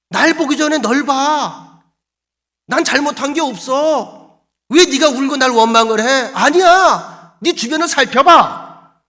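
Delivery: high, loud, insistent rebuke; acted scolding. God tells Joshua to look at himself, scolding. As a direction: push back hard at the whiner.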